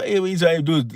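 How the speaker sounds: ordinary delivery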